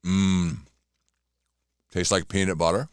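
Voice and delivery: talking with a mouth full; words round a mouthful of cookie. Real, not acted. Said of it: "As I'm talking with my mouth full".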